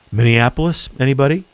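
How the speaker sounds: ordinary talk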